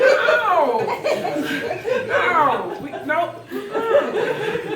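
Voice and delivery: loud, high, laughing no-no-no; laughing protest. Retold or imitated. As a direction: refuse it with mock outrage. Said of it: "I'm like".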